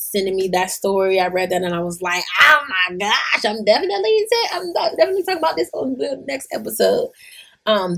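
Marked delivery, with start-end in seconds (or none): 2.4-7.1 excited squeal. Retold or imitated. Excited reaction reading story.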